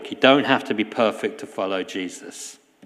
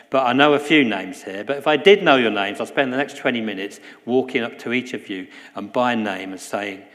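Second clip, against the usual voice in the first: usual speaking voice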